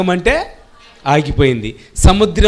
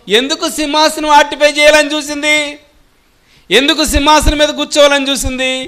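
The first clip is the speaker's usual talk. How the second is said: much higher, louder rhetorical questions; fiery preaching; 10 semitones higher, 5 dB louder than usual. Acted. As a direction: hammer the question at the crowd.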